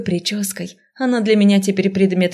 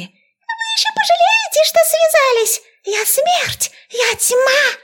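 tiny rat voice, very high and loud; squealing rat; 3 dB louder, slower than usual. A narrator's reading. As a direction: squeak out threats like a cartoon rat. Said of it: "верещала вторая".

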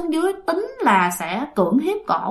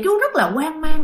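normal talking voice

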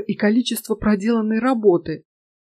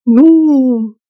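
long, raised, drawn-out hesitation; embarrassed hesitation; 4 semitones higher, 8 dB louder than usual. A narrator's reading. Girl asked why she came, stalling in embarrassment. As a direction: stall on the word, caught off guard. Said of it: "Мне таки удалось её смутить".

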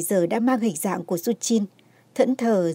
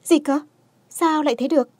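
startled, high and loud disbelief; jolted by shocking news; shocked; 7 semitones higher, 4 dB louder than usual. A narrator's reading. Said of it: "giật mình sửng sốt".

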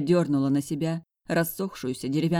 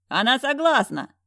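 high, loud triumphant shout; man shouting the good news to relatives; yelling; 9 semitones higher, 5 dB louder than usual. A narrator's reading. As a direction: announce it to the whole family. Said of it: "проорал Глеб".

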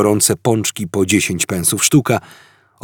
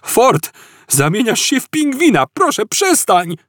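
much higher, frantic begging; desperate pleading; 13 semitones higher than usual. Acted. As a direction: beg your friend to stop. Arthur begs Ford, turning penguin.

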